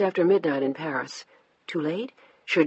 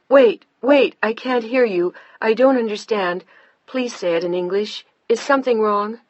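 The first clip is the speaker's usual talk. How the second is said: loud, higher, urgent into the phone; alarmed calling; 5 semitones higher, 4 dB louder than usual. A narrator's reading. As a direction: push through a bad line, alarmed. Panicked on bad phone line.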